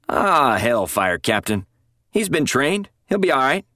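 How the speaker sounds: slow drawn-out hellfire, then high and dismissive; a colonel waving off worry, pitched high; scoffing colonel. A narrator's reading.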